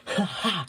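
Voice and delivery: loud, high "ha ha" of victory; triumphant laugh. A narrator's reading. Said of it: "He laughed in triumph".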